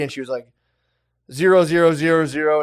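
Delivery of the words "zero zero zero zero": loud, mocking zero zero zero zero; mock shouted digits. Wife taunting the scam caller with loud zeros. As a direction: bark the zeros out at the scammer. Wife reading fake card number.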